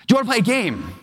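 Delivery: put-on high, eager child's question; lightly put-on small child voice; imitated little daughter. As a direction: pitch it up like a little girl asking Dad. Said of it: "And she says".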